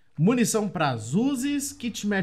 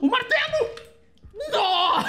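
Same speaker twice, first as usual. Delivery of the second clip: high, loud shriek of shock; startled yell; 5 dB louder than usual. Real, not acted. Gamer startled by the giant hammer, shrieking. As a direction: cry out in sudden alarm.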